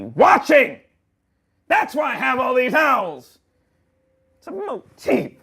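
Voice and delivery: high loud ranting threat; angry shout. Acted. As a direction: rant it like a paranoid boss.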